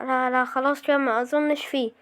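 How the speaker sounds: ordinary talk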